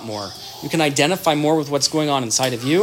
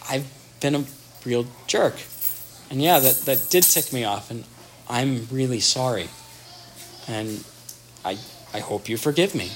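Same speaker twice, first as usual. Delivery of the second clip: remorseful apology; 3 semitones lower, 6 dB quieter than usual. Retold or imitated. Husband apologising to his wife.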